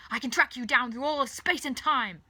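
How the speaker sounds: loud, threatening shout; yelling. A narrator's reading. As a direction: yell the threat after someone escaping. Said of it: "he yells".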